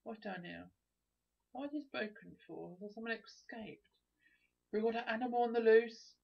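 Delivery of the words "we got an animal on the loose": loud, higher playful alert; mock alarm call. Calls out mock alarm in game.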